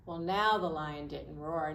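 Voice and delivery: usual speaking voice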